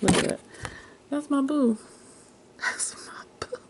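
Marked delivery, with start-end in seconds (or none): 1.1-1.8 high-pitched, fully voiced cooing; voiced fond gushing. Real, not acted. Showing off her favorite's picture, high fond coo. 2.6-3.6 hushed, voiceless whisper; fond whisper. Real, not acted. Doting on her favorite's picture, fond whisper.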